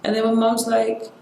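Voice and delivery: ordinary talk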